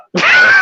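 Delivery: high, loud burst of laughter; real laugh that jumps up high; shrieking laugh. Real, not acted. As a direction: let a big squealing laugh out.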